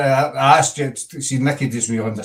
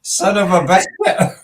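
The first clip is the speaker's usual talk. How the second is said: slow, higher copy of a catchphrase; mimicked catchphrase; 5 semitones higher, 4 dB louder, slower than usual. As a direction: draw it out, pitched up in imitation. Copies her high-pitched catchphrase.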